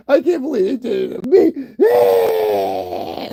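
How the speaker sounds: hammed-up tearful confession building to a wail; mock crying. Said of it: "They sound like they're crying".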